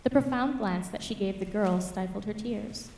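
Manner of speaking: ordinary delivery, nothing marked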